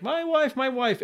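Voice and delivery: high-pitched mock lament; mock distraught. Husband runs to his coworker about his wife, mock distraught. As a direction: wail it lightly, imitating a heartbroken husband. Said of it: "just all sad".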